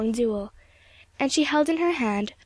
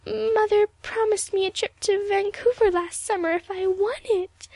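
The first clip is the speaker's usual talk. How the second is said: high, halting plea with stammered starts; crumpling; 10 semitones higher than usual. A narrator's reading. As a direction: crumple into a pitched-up, distressed confession. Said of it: "muttered Evelyn".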